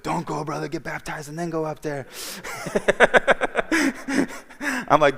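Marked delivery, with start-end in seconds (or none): 0.0-2.1 higher-pitched put-on voice of a friend, lightly done; friend's urging voice. Friend Jose voiced giving advice. 2.1-4.8 high burst of laughter; big laugh. Real, not acted. Laugh after Jose's line.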